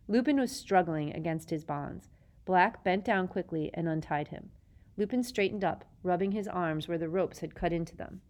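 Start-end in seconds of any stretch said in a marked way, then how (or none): none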